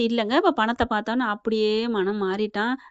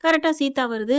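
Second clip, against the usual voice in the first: ordinary delivery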